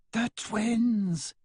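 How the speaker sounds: put-on light up at the name in a faint foreign accent; exclaiming. A narrator's reading. Woman recognizes Bailey's friends, delighted high exclaim. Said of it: "the woman exclaims".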